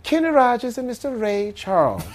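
high, gracious TV hostess; high-pitched vintage show host. Acted. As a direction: introduce guests in a high, genteel voice. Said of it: "You hear".

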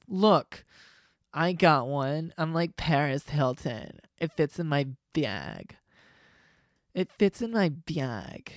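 slow, drawn-out show-off socialite; mocking rich-girl voice. Girl showing off her chihuahua, slow mocking drawl. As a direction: stretch it out like a vain dog-toting girl. Mocks girls showing off tiny dogs.

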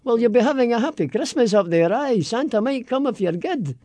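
Aberdeen Doric accent. Shown as a demonstration. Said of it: "in Aberdeen they have this wonderful Doricky thing".

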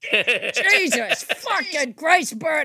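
exasperated Boston complaint; Boston accent. Acted. Parent scolding Bert about the kids, exasperated Boston accent. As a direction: scold in Boston dialect, dragging the opening curse. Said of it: "a Boston accent is very hard to do".

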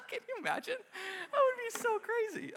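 very high, laughing squeal; real laughter. Real, not acted. Pastor cracking up at his imagined scene, helpless laughing. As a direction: squeeze the words out through laughter.

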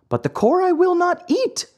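high, indignant puppet protest; angry refusal. A narrator's reading. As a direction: refuse crossly in a high childish voice. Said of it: "in an angry tone".

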